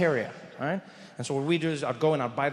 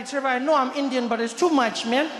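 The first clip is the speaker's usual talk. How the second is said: higher-pitched friend's voice; 7 semitones higher than usual. Retold or imitated. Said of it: "And he said".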